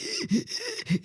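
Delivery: high, wheezy mock gasping for air; imitated hyperventilating gasps. Storyteller showing how she fainted on the kitchen floor, imitated gasping. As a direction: act out losing your breath. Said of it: "I lost my breath. I was like".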